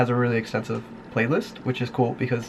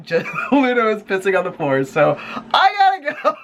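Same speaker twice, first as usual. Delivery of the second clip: laughing; 10 semitones higher than usual. Real, not acted. Laughing while explaining.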